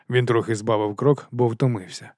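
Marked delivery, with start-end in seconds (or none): none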